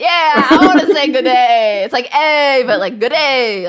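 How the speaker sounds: hyped-up g'day. Shown as a demonstration. Learner delighted by the Australian greeting, hyped-up delivery. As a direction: throw the greeting out with hype. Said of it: "Like, you're like, it's hype".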